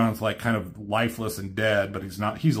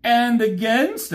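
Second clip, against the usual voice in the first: Starscream voice; 11 semitones higher, 4 dB louder, slower than usual. Retold or imitated. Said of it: "He says".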